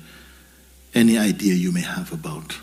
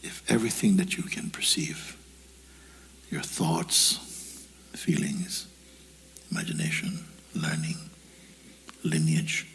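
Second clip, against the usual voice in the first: guided meditation; 6 dB quieter than usual. Acted. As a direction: let each word sink in softly. Guides listeners to empty the mind.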